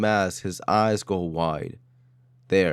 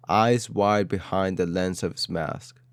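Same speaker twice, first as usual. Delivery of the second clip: plain reading voice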